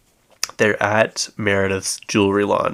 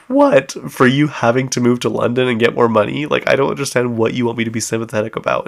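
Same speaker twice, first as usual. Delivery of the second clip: incredulous; 3 semitones higher, 4 dB louder than usual. Retold or imitated. Kyle confronts Sutton about her stress, incredulous and fast. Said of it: "And she's like".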